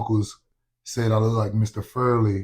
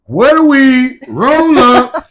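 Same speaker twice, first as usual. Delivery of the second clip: high, loud, slow sing-song calling; hype call; 13 semitones higher, 12 dB louder, slower than usual. Pretend. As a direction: keep calling out like a hyped friend. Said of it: "all day was like".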